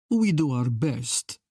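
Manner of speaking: lightly voiced Italian man; Italian accent. A narrator's reading. Queen's Italian attendant stepping in, Italian accent. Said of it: "he said, in an Italian accent".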